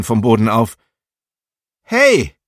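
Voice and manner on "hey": loud, high-pitched yell of "Hey"; shouted call. A narrator's reading. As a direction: shout out to stop someone, pitch leaping up then falling. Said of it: "schrie er, so laut er konnte".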